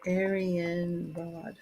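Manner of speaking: Aryan Rod drawn out slowly and softly; slow, quiet test of saying "Aryan Rod"; sounding out the name. Shown as a demonstration. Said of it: "I could be saying it right".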